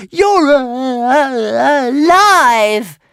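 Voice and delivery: very high, loud, choked-up squeal; tearful dragon. Acted. Toothless overjoyed to find Hiccup alive, tearful squeal. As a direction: sob it out in joy.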